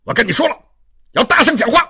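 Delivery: high, loud impatient order; angry shouting. Acted. Inspector insisting the prisoner speak up, shouting. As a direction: shout over his plea. Inspector again demands louder speech.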